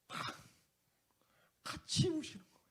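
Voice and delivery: high, soft and tearful; fighting back tears; voice breaking. Real, not acted. Pastor choking up telling of Jesus embracing him, tearful. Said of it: "자주 울어요, 제가".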